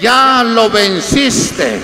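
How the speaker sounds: loud, very slow divine proclamation; retold heavenly voice, ringing out to the congregation. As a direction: proclaim it forcefully, drawing out each word. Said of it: "escuché la voz y me dijo".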